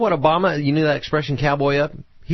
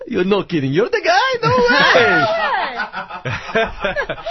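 amazed, laughing; 4 dB louder, slower than usual. Acted. Acted hostage scene reaction.

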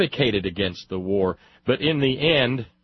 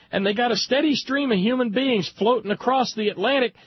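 much higher-pitched pleading voice; voice of Southern reason; 13 semitones higher than usual. Acted. Southern moderate arguing to the boys against war, pleading high. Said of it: "calm, sane, rational voices in the South".

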